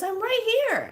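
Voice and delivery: very high little imitated voice; youngster calling back. Young one answering in smoky room, high calling voice.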